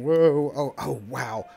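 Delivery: sudden loud gasped whoa; startled whoa. Real, not acted. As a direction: caught off guard, loud. Startled on VR roller coaster.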